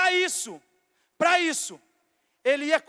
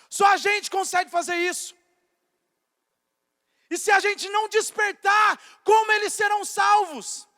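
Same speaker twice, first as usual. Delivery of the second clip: loud, high preacher's exhortation; rally them, voice raised; full-force preaching; 5 semitones higher, 7 dB louder than usual. Acted. (pregação exaltada em voz alta)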